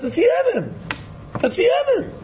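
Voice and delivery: high, sharp mock accusation; imitating an angry man blaming someone else. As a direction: point the finger in a high voice. Voicing the angry blamer.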